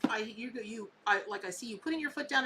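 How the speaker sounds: ordinary delivery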